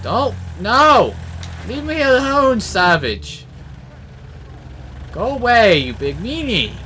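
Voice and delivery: loud, high panicked yells at the tank; panicked shouting. Real, not acted. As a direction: scream at it to leave you alone. Pleading with an enemy vehicle.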